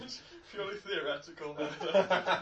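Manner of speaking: slow, loud words through laughter; real laughter breaking up the words; laughing hard. Real, not acted. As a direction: force the line out while cracking up. Cracking up mid-sentence.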